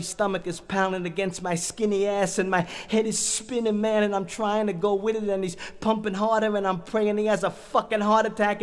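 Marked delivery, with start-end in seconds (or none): none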